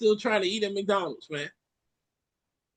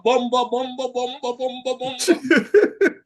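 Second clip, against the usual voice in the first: mock cursing; 3 semitones higher, slower than usual. Stands in for Jamaican cursing.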